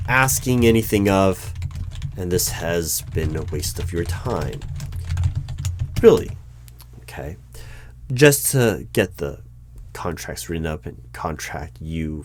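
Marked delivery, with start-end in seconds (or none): 6.0-6.3 high, loud, sudden exclamation; incredulous outburst. Acted. Interviewer reacts to a rude applicant, incredulous and loud. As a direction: jump up in pitch, taken aback.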